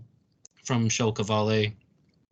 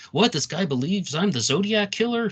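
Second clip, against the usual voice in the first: higher-pitched, incredulous quote; Shel Kavale; 9 semitones higher than usual. Retold or imitated. Host quoting the suspect's reaction to the accusation, mock incredulous. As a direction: imitate someone scoffing in disbelief, pitch jumping up. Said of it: "he's actually talking about you, saying".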